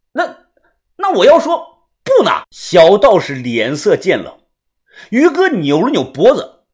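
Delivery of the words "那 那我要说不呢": high-pitched taunting refusal; hesitant start, then high defiant question; defiant challenge. A narrator's reading. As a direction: push back cheekily in a high voice. Defies demand to strip.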